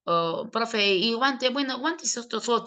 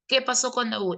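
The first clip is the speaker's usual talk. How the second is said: ordinary delivery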